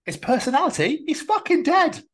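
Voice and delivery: high, loud incredulous squeal; can't believe what you just heard; shrieking disbelief; incredulous outburst. Real, not acted. Mock outrage at friend's claim.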